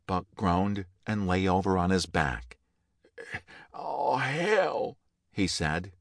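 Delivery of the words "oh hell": dying groan. A narrator's reading. Dying man's last words.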